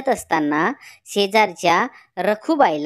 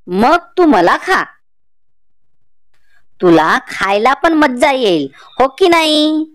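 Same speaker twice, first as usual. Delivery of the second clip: louder, slow bargaining grandmother; clever old woman; 3 semitones higher, 7 dB louder, slower than usual. Acted. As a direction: talk the cheetah round, loud and slow. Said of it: "म्हातारी म्हणाली".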